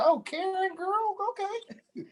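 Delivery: very high-pitched teasing quote; high, drawn-out mock exclamation; playful mock reaction. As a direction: squeal it playfully, dragging out the end.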